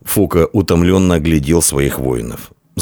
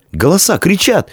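put-on high-pitched, alarmed young soldier; frightened; 13 semitones higher than usual. A narrator's reading. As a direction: blurt it out in fright. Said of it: "испуганно пробубнил молодой воин".

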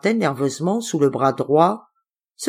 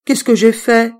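innocent, gentle question; a girl asking her uncle what she did; 6 semitones higher, 7 dB louder than usual. Acted. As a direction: ask as if you did nothing. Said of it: "la voix innocente et harmonieuse".

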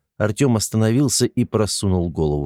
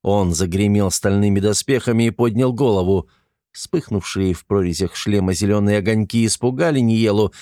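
ordinary delivery, nothing marked

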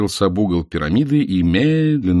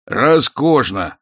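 slow, delighted exclamation; 6 semitones higher, 4 dB louder, much slower than usual. A narrator's reading. Nikolai Ilyich delighted by the invitation, gleefully. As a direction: savour the word with joy. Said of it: "обрадовался Николай Ильич".

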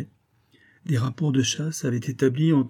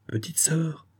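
soft, higher, gently sorrowful address; sad; 7 semitones higher, 6 dB quieter, slower than usual. A narrator's reading. Victor sadly appeals to his little sister, softly sad. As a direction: say it softly with understated sadness. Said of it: "dit tristement Victor".

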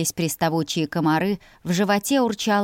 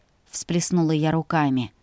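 ordinary delivery

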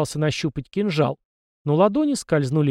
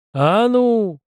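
higher, louder barked command; growled order; 8 semitones higher, 6 dB louder than usual. Acted. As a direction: snap it like an old man's growl. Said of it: "рыкнул старик". (рыкнуть, громко и властно)

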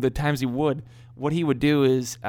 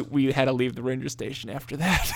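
ordinary talk

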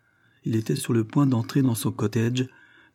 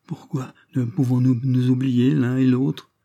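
usual speaking voice

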